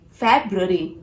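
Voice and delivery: "February" as FEB-ruh-ree; "February" with a clear B; correct February. Shown as a demonstration. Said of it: "not February".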